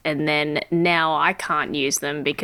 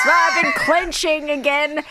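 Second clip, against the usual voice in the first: mock realization shout; 9 semitones higher, 3 dB louder than usual. Retold realization about clenching.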